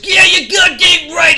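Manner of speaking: slow, loud, high bellow; wrestler shout. Acted. Scott Steiner answering Shane Helms, loud slow bellow. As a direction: roar it like a wrestling heel.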